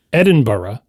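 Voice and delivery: Edinburgh ending in "buh-ruh"; three-syllable-plus pronunciation. Shown as a demonstration. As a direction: say Edinburgh with both final syllables, ending in a schwa. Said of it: "Here's three valid pronunciations".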